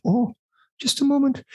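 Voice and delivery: high mock Scottish falsetto; Mrs Doubtfire-like Scottish lady. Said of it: "channeling of Missus Doubtfire".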